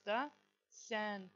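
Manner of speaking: normal talking voice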